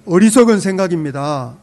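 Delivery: loud, punched declaration to the neighbour; loud firm declaration. Shown as a demonstration. Pastor urges the congregation to tell their neighbour, loudly firm. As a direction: say it out loud like a verdict. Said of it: "옆 사람에게 한마디 하시죠".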